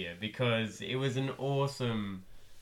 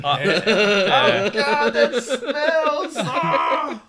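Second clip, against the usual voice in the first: high, loud laughing yelp of disgust; laughing mock disgust; 9 semitones higher, 9 dB louder, much slower than usual. Fan acting a disgusted hero while laughing. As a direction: squeal through laughter at a stink. Acting character smelling stick.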